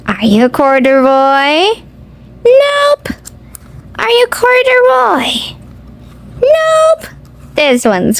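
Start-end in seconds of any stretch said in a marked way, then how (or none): none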